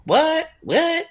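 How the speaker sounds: imitated Southern drawl, the last what jumping way up in disbelief; mock Southern drawl of disbelief. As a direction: mimic a friend's Southern drawl, stunned. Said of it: "in his Southern drawl".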